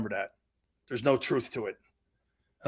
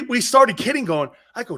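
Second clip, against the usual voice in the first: ordinary talk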